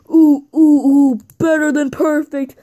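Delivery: loud boasting wrestler impression; Chris Axel. Wrestler makes his entrance, loud play-acted boast. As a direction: crow it loudly like a cocky wrestler. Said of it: "Here comes Chris Axel, and he's all like".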